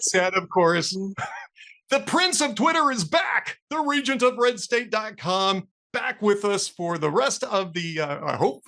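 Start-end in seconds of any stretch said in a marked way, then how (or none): 1.9-8.0 slow, higher-pitched radio sign-off; mock-grand announcer. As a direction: draw out the guest's grand titles like an announcer. Host's mock-grand sign-off introduction of guest.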